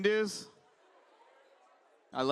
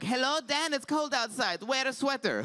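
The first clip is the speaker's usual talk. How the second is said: higher Middle Eastern grandmother voice; Middle Eastern grandma; 5 semitones higher than usual. Retold or imitated. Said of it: "saying grandmotherly things".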